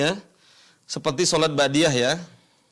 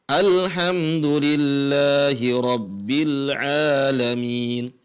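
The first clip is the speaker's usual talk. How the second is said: slow, chanted, lower Quran verse; Quranic recitation; 4 semitones lower, much slower than usual. Shown as a demonstration. Teacher recites opening verse as example, slow chant. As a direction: intone it slowly like prayer. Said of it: "yang tidak harokat asli, alhamdulillah".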